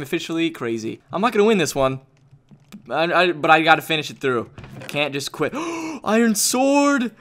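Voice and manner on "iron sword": high, drawn-out excited cry; thrilled. Real, not acted. Player finding an iron sword, thrilled. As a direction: crow over a lucky find.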